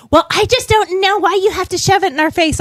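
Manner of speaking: high-pitched imitation of a griping stranger; mock indignant complaint. Woman complaining to a mask wearer, mock indignation.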